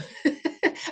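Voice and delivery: breathy high laugh. Real, not acted. Laughs about spending day with strangers.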